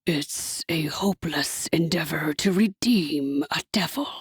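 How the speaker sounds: a soft devil's whisper, slowed; devil cooing. A narrator's reading. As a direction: coo it like a gloating devil. Hasatan's voice.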